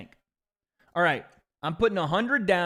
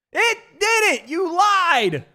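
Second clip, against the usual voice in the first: high, drawn-out yell of betrayal; loud, high-pitched protest; outraged shout; 13 semitones higher, 8 dB louder, much slower than usual. Real, not acted. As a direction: holler the accusation. Shouts at lying website.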